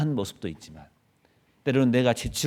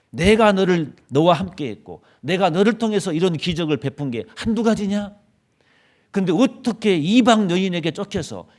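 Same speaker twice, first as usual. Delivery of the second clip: loud, higher furious scolding; 7 semitones higher, 9 dB louder than usual. Pretend. God scolding Elijah for fleeing, loud angry rebuke. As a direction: berate him like an exasperated father. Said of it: "야단쳤을 것 같아요".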